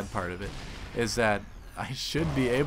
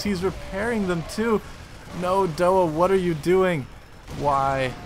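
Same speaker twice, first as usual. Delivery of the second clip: loud, higher, anguished cries at the screen; dismayed shouting; 6 semitones higher, 5 dB louder than usual. Real, not acted. A player watching his own army die, shouting in dismay. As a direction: yell at yourself as your units die.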